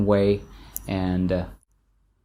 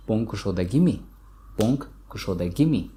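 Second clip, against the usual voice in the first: Khojand dialect: "кушодагими" with "mi" rising at the end; northern Tajik dialect question with "кушодагими". Shown as a demonstration. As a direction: ask it the Khojand way, ending on a rising "mi". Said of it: "in the northern dialect".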